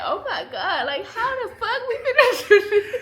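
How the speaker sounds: high, loud laughing mock panic. Wife quoting husband's old budget panic, high laughing mock panic. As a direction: squeal it again through laughter.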